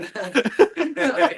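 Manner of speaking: short burst of real laughter; embarrassed laugh. Real, not acted. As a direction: laugh it off, caught out. Laughs after being told off.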